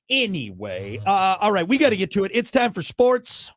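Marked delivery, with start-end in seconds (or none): 2.3-3.5 slow, loud, high segment announcement; announcer intro. Acted. Radio host opening the sports segment in announcer voice.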